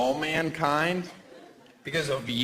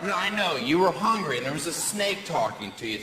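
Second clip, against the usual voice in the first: ordinary delivery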